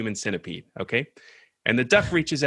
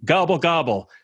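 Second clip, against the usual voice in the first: gobbling like a turkey; turkey gobble; 5 semitones higher than usual. Retold or imitated. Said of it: "the turkey says".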